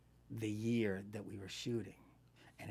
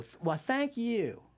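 slow, higher Southern lilt; Southern accent; 10 semitones higher, 4 dB louder, slower than usual. Retold or imitated. Actress answering the stewardess at the door, Southern accent. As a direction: lay on a drawn-out Southern accent. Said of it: "checked into the hotel with the accent".

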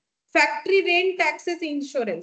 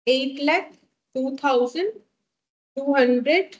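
figure broken into chunks with pauses; slow dictation. Real, not acted. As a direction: dictate the number piece by piece. Repeats total slowly for note-takers.

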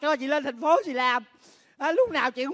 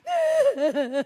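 scornful laugh; 7 semitones higher than usual. Acted. Sister laughs at the question.

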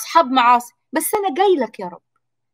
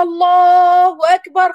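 Allahu akbar, higher, louder and slow; drawn-out takbir; 5 semitones higher, 4 dB louder, much slower than usual. Shown as a demonstration. Preacher demonstrating the takbir at prayer, loud and slow. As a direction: stretch it out like the call to prayer. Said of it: "وهكلم ربنا".

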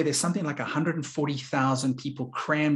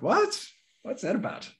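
retold high-pitched disbelieving "what"; incredulous retort; 10 semitones higher than usual. Speaker retells challenging the headmistress's brush-off, incredulous. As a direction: voice leaping up in mock-startled protest.

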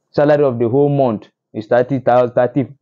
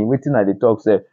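ordinary delivery

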